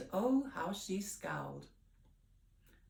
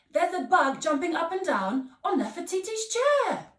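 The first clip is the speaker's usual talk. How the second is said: put-on high, loud child voice; excited Kofi; 10 semitones higher, 11 dB louder than usual. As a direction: point it out eagerly. Kofi describing bug.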